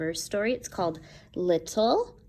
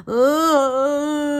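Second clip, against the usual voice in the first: louder, higher moaning oh; 7 semitones higher, 7 dB louder than usual. Shown as a demonstration. Teacher showing the class a moan, moaning. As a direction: show them a spooky moan. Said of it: "A moan is like this".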